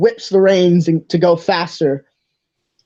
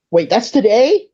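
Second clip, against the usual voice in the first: higher-pitched, surprised question; startled; 7 semitones higher than usual. Acted. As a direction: suddenly realise it with a rising voice. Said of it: "His eyes kinda widen".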